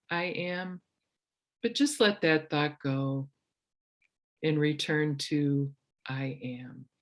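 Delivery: soft, unhurried meditation guide voice; calm retreat leader guiding the breath; guided meditation. Acted. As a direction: ease them gently into stillness.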